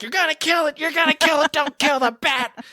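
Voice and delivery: high frantic pleading shout; panicked yell. Retold or imitated. Guy panicking over the broomstick, high frantic yell. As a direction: beg them to spare the bat, voice cracking high. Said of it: "one guy freaking out".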